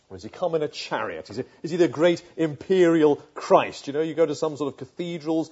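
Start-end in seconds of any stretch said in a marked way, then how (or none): none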